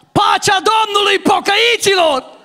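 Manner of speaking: hamming up a churchy greeting at friends from the car window; shout out the window. Retold or imitated. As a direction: bellow it across the bus stop. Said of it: "strig pe geam".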